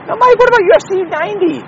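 high, fast put-on questioner voice; mock listener voice. Podcast host asking himself in a squeaky fan voice.

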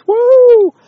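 loud high whoop of glee; a sudden shrill party cheer; excited whoop. Real, not acted. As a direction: let out a big celebratory woo. Cheering over getting ashes.

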